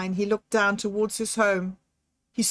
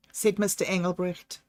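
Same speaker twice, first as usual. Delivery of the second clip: plain reading voice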